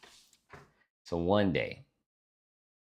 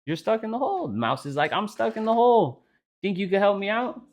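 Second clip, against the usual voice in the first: pitched up, mock-friendly bro banter; high goofy voices; 10 semitones higher, 4 dB quieter than usual. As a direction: ham up the two animals talking. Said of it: "the elephant's like".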